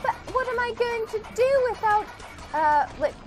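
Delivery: high, whiny mock lament; mock-sad teen. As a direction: wail like a heartbroken young contestant. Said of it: "she'll be like".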